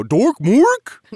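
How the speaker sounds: "Dork Mork" with a high, loud question rise; silly mock question. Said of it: "I go".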